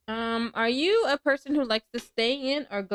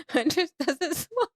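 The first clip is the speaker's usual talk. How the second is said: laughing; 10 semitones higher, slower than usual. Real, not acted. Streamer cracking up at chat.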